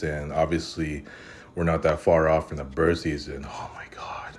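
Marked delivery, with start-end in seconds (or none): 3.5-4.2 hushed dread; whispering. Real, not acted. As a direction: whisper it under your breath. Dreading the coming season.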